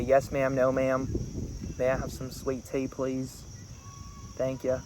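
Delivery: soft Southern drawl, politely ordering; Southern US accent. Retold or imitated. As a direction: slip gently into a Southern US accent. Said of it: "had I forced a Southern accent".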